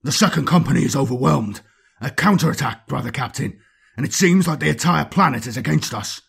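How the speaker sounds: breathless call. Acted. Chaplain bursting into the ruin, breathless urgent call. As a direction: burst in shouting the alarm. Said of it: "said breathlessly".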